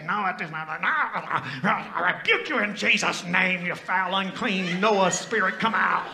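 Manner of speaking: loud, slow mock exorcism of a friend; hammed-up casting out the Noah spirit; mock deliverance rebuke. Noah mimicked rebuking his pastor in mock deliverance. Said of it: "He'd say".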